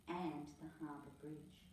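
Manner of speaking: ordinary delivery, nothing marked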